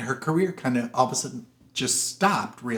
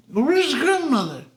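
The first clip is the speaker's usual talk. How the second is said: put-on Gladys Cooper, drunk; 12 semitones higher, 3 dB louder than usual. Drunk duchess walks in looking for grandmother, tipsy wail. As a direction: whoop and slur like a tipsy grande dame. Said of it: "she's drunk through the whole movie".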